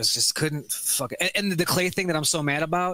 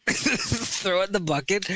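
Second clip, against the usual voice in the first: slow, laughing through the words; amused slow echo; laughing repeat; 5 semitones higher, slower than usual. Shown as a demonstration. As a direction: savour the phrase while laughing. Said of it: "have a little fucking throw it in the bucket".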